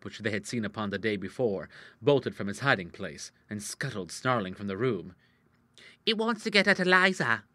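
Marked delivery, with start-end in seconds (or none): 6.1-7.4 lightly voiced youth, raised pitch; confidential page boy. Page boy to the visiting ladies, confidential. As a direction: confide it as a page boy, higher than usual. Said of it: "in a confidential whisper".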